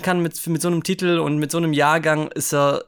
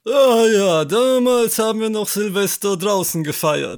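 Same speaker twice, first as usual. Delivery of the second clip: lightly voiced old-timer, louder; boomer voice; 4 semitones higher, 4 dB louder than usual. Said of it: "heute ist die Boomer-Episode".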